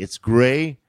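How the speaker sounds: ordinary delivery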